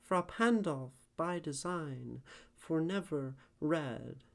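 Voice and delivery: slow, measured poetry recital; poem reading. Acted. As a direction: read it slowly, feeling the iambic beat. Reads Browning poem aloud.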